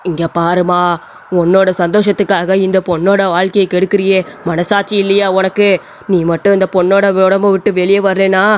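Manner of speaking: low-pitched, admonishing holy man; stern exorcist priest. Acted. (கண்டிப்பான பூசாரியின் தாழ்ந்த குரல்)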